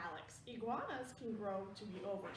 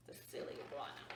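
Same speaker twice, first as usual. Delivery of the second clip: whisper; 11 semitones lower, 4 dB quieter than usual. Real, not acted. Reading to a child, whispering about the picture. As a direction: whisper it like a shared secret.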